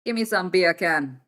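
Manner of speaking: slow, lightly put-on Jamaican accent asking for beer can; playful Jamaican accent, slow and loud; mock Jamaican accent. Shown as a demonstration. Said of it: "it sounds like a Jamaican saying bacon".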